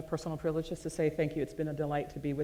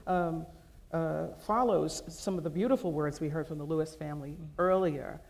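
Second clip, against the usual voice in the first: normal talking voice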